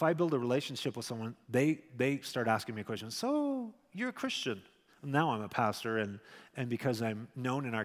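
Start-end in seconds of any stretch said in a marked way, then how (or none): 3.1-4.6 curious neighbor. Retold or imitated. Imitating a curious coworker's question.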